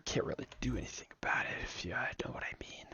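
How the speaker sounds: fast, breathy secret whisper. Pretend. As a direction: whisper it like a conspiracy. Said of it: "I don't know why I'm talking like this".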